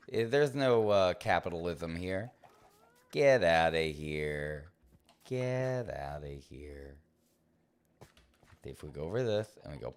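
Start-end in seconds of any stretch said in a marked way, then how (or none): 3.1-4.7 slow drawn-out mock dismissal. 5.3-7.0 a lazy murmured get outta here; slow drawn-out, softer. Pretend. Repeats the dismissal.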